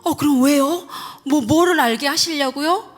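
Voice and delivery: gasp, then fire questions upward; startled. Retold or imitated. Reenacting her shock at God's answer, startled questioning. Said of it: "그 제가 놀래가지고".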